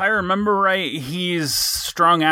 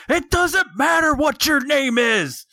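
high, slow wrestler catchphrase; wrestling promo; 11 semitones higher than usual. Pretend. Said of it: "devolved into a wrestling promo thon".

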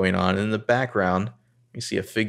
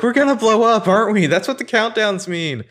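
much higher, louder whiny panic; hammed-up hysterical teen; mock panic; 13 semitones higher, 5 dB louder than usual. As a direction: shriek it as the realisation hits. Hannah voiced panicking again.

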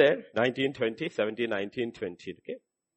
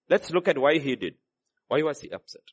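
usual speaking voice

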